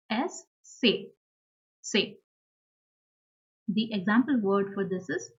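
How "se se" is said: Se said as "tseh"; German letter C. Shown as a demonstration. Teacher showing learners the German alphabet, C as Se. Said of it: "C is pronounced as".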